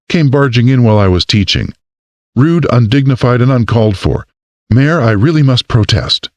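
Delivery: a hint of an offended teacher; indignant woman. A narrator's reading. Erica protests, higher voice.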